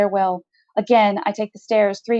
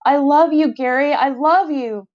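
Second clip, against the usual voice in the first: yearning; 6 semitones higher, 3 dB louder than usual. A narrator's reading. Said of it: "The yearning in his voice is unbearable".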